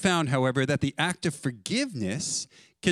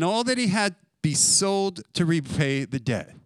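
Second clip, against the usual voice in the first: raised, earnest reading of scripture; preacher reading the parable aloud; 3 semitones higher than usual. Real, not acted. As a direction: read the parable with weight.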